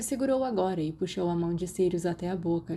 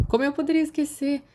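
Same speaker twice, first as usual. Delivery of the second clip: high, loud, envious retort; jealous; 9 semitones higher, 5 dB louder, faster than usual. A narrator's reading. Sirius, jealous he missed the boggart, high and loud. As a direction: let the jealousy bite. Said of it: "com uma nota de ciúmes em sua voz".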